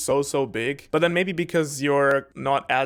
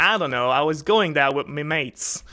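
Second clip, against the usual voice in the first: Australian accent impression; 2 semitones higher, 5 dB louder than usual. Friends ask for Jim Jeffries, Aussie accent attempt. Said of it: "He's like".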